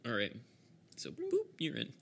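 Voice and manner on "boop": high little "boop" sound effect; teleport boop. Acted. Game master teleporting the player indoors, high little boop. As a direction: pop out a short high blip.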